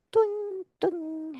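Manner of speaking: high bouncing toung, toung; playful high-pitched boing noise for the heel bounce; bouncy toung sound. Shown as a demonstration. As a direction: squeak out a springy trampoline sound. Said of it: "comme si mes talons atterrissaient sur des petites trampolines".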